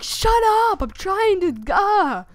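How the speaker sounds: high, loud retold protest; exasperated yell. Visitor answering haunted house actors screaming his name, exasperated. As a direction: shout back, fed up and flustered.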